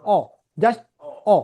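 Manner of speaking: অ kept short; a brief অ, not drawn out; short vowel. Shown as a demonstration. Teacher showing that this one is short, short vowel. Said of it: "short হচ্ছে".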